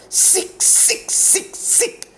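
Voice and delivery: hissed in a whisper, spitting each word; whispered, spat with rage. A narrator's reading. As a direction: spit it out in a furious whisper. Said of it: "spat the girl".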